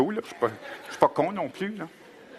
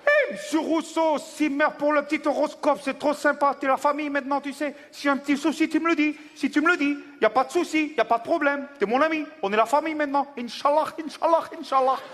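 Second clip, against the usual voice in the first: higher, eager buddy voice at a fast clip; excited new friend; 7 semitones higher than usual. Acted. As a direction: gush like an overfriendly pal. New friend voiced, customs scene.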